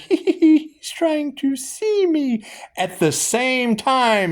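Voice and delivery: very high, slow, giggling fairy voice; strange fairy voice. Retold or imitated. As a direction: squeak it out like a laughing sprite. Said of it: "I hear a strange fairy voice".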